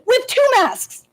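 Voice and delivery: high, exasperated outburst; upset. Real, not acted. Parent telling a board about a quarantined child, upset. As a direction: stretch it out in real indignation. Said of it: "just makes me so upset".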